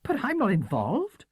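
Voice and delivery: high strained voice of Matthew, control slipping; Matthew losing control. A narrator's reading. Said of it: "his control suddenly slipping".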